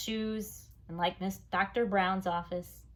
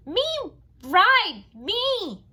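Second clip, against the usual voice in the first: put-on much higher, loud little-kid demand; demanding toddler; 13 semitones higher, 11 dB louder, much slower than usual. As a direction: insist like a toddler.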